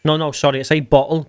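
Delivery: ordinary talk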